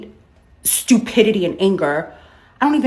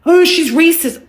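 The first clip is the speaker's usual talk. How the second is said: shrill mock accusation, much higher and louder; mocking accuser; 12 semitones higher, 8 dB louder than usual. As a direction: sneer it like a smug internet critic. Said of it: "all people are doing is sitting here and".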